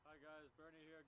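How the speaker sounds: usual speaking voice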